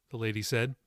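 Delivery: ordinary delivery, nothing marked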